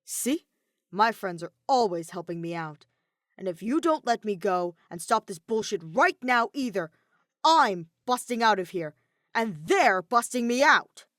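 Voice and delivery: loud defiant threat, slowing at the end. A narrator's reading. Kaito threatens to break out, loud and angry. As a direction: shout him down, bold and angry.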